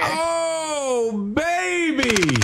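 high, thrilled cry; excited. Real, not acted. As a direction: whoop with delight. Pro player revealed.

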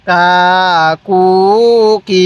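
"কা, কু, কি" each held long and level; measured syllable chant of "কা, কু, কি"; drawn-out vowels. Shown as a demonstration. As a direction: stretch "কা, কু, কি" with pauses between. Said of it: "পড়ুন".

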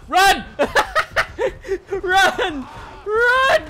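loud, high yells to run; laughing panic. Real, not acted. Player fleeing the fight, laughing panicked shouts.

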